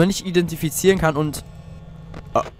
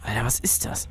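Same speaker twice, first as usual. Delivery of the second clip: ordinary talk, nothing marked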